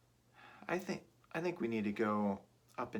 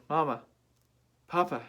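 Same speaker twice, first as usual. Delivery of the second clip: surprised; 5 semitones higher, 5 dB louder than usual. Acted. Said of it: "He looked so surprised".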